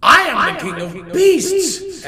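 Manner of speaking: fast loud jumbled echo. Acted. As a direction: tumble it back like an echo. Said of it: "echoed the lion in the well".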